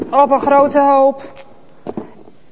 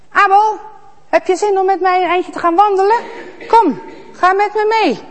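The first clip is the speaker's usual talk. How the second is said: higher, fast coaxing voice; sly Kaïn; 8 semitones higher, 4 dB louder, faster than usual. Acted. Kaïn luring Abel for a walk, falsely friendly. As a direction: coax him along too sweetly.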